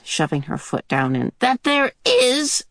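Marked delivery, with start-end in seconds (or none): 1.4-2.6 a grunt of effort on the words. Acted. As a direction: strain as if pulling on a boot. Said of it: "she grunted".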